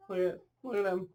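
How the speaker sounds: ordinary delivery, nothing marked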